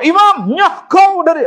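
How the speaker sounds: high, loud mock yell chasing the imam off; hammed-up rude bellow at the imam; rude mock shout. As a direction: bark it out like a cheeky brat. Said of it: "Ada saya nak halau Tok Imam".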